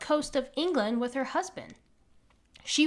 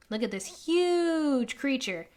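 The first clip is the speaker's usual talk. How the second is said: ordinary delivery, nothing marked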